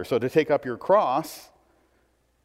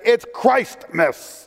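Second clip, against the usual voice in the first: slow, pointed, higher correction; 8 semitones higher, 4 dB louder, much slower than usual. Retold or imitated. Shopper correcting the cashier, slow and pointed. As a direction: set them straight, word by word. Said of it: "the person turned around and said".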